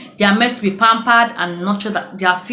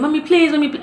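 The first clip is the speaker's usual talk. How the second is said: put-on high, pleading little girl's voice; a daughter begging her mother for mercy, high; begging child; 8 semitones higher, faster than usual. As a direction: plead like a scared small daughter. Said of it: "She was begging".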